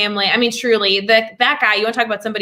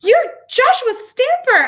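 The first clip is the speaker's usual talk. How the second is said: high-pitched mock scream of recognition; retold scream; 3 dB louder than usual. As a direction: squeal the name as if starstruck, re-enacting it. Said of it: "I just screamed his name".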